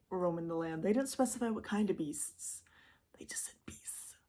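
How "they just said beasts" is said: whisper. Real, not acted. Whispered aside on beasts.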